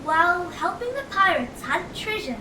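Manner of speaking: ordinary delivery, nothing marked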